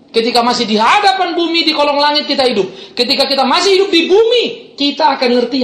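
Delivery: high, loud pulpit declaration; impassioned preaching. Real, not acted. Preacher urging the congregation to trust God's care, impassioned. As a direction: drive each phrase up to a ringing peak.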